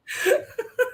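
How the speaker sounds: shrieking laugh; squealing giggle; high squeal of laughter. Real, not acted. Laughs at co-host joke.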